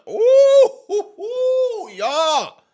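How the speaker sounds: loud, high-pitched "ooh hoo hoo" whoop; outraged whooping. Real, not acted. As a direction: let out a piercing whoop of disbelief. Exclaims in outrage at evictions.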